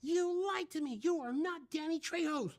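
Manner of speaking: high, indignant scolding voice; mock-angry accusation. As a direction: imitate an outraged assistant director. First AD accuses him in anecdote.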